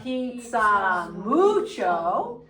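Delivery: slow, drawn-out Spanish for repeating. Shown as a demonstration. Teacher to class to repeat after, slow and drawn out. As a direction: stretch out "gusta pizza mucho" word by word. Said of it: "say it with me".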